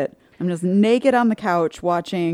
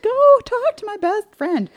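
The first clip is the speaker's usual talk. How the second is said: high, slow mocking voice of the pushy sister; mock whiny sister; 5 dB louder, slower than usual. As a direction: whine it out like a nagging sister.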